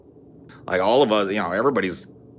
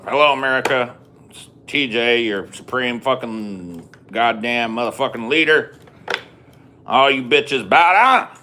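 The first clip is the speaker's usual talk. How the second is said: higher, louder, brash crude president addressing the nation; mock TJ presidential address; 4 semitones higher, 3 dB louder than usual. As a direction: play a foul-mouthed president on air, full swagger. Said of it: "imagine T J giving a presidential ad-, uh, address".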